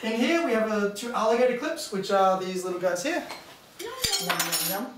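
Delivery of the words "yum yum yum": mock hungry yum. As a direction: voice the clips as if they're eating. Feeding graphite to alligator clips.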